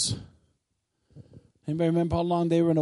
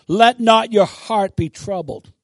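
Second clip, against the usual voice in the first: loud, slow and forceful; commanding declaration; 7 dB louder than usual. Shown as a demonstration. Preacher shows how God wants it said, commandingly. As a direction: declare it like an order. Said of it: "No, God wants you to say".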